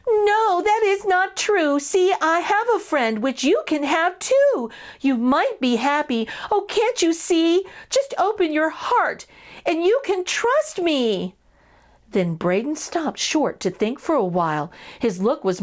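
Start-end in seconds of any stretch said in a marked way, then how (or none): 0.1-11.3 much higher, urgent mouse voice; crying out, pleading. A narrator's reading. Elvin pleading with Braden to believe in friends, high. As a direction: plead with him to trust. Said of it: "Then Elvin did cry".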